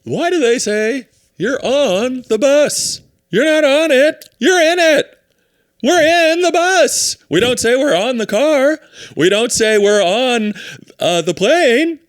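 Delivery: high-pitched, drawn-out observational rant; Seinfeld. Acted. Comic ranting about prepositions, high exaggerated impression. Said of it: "Seinfeld gets on and goes".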